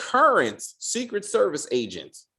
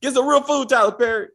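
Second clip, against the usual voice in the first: mock yelling at the screen; 8 semitones higher, 5 dB louder, faster than usual. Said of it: "I was like".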